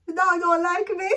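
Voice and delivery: high and loud, laughing through the line; laughing quote. Retold or imitated. Woman at the man's house complaining of the dog, laughing. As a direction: giggle out the complaint. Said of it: "and yeah, say".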